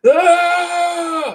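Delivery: high held shriek; hammed-up horror scream; long high scream on waking; mock scream. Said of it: "this old guy's like".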